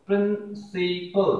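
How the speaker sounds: three measured beats on प्रिंसिपल; in three parts. Shown as a demonstration. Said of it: "इसे तीन parts में बोलते हैं".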